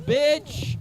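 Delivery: high, loud yell of "Bitch!"; shouted swear. Acted. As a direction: bark the word out high and loud. Comic shouts the word itself.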